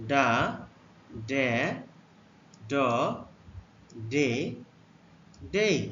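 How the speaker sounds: teacher modelling ডা ডে ড ডে ডেই; letter sounds demo. Shown as a demonstration. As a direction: model ডা ডে ড ডে ডেই with a pause after each. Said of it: "ডি এর উচ্চারণ".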